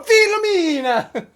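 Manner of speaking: Filomena! called out high and loud in mock Italian; hammed-up Italian call. Host mocking how Italians call the name, loud mock Italian. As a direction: belt the name in a mock Italian singsong, slow. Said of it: "No one's walking around going".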